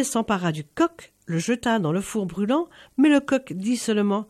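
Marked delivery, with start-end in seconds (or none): none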